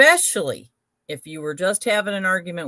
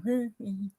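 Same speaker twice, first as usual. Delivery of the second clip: casual humming; 5 semitones higher, 8 dB quieter than usual. Retold or imitated. Humming calmly while brushing teeth.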